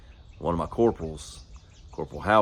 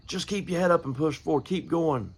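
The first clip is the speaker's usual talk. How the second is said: pitched way up, urging the crowd on; preaching lift; 9 semitones higher than usual. Shown as a demonstration. Preacher urging his church to keep going, high ringing call. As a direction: lift the voice high and press them forward. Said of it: "it's easy to say".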